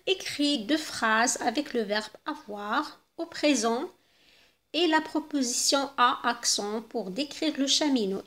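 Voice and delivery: slow, deliberate French read-aloud; slow dictation. Shown as a demonstration. Teacher dictating to pupils, slowly and clearly. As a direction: spell out each word for the pupils. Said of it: "teacher dictates French instruction".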